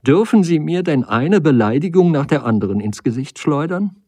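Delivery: laut und höher, schnell vorwärts drängend; entrüstet zurückschleudern; empörter Ausruf. A narrator's reading. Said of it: "rief sie". (sie wirft ihm empört Beleidigungen vor)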